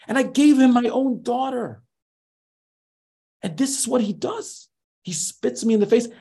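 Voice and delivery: high, strained rant of an outraged father; father-in-law raging over the betrayal, pitched high; furious father. Retold or imitated. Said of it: "Her father is seething, seething. He's furious".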